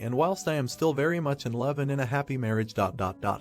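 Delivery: ordinary delivery, nothing marked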